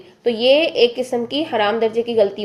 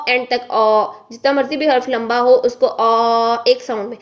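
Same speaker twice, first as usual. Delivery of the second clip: ordinary talk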